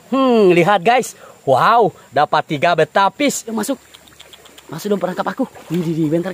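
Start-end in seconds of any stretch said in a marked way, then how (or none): none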